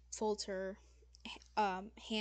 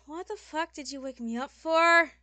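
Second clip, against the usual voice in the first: loud, higher, exasperated groan into a rant; cranky demon lady, just woken; 5 semitones higher, 14 dB louder than usual. Acted. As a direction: grumble loudly at being woken up. Game master voices irritated demon lady.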